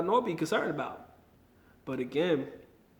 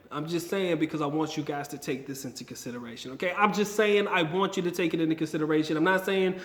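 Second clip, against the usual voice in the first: ordinary delivery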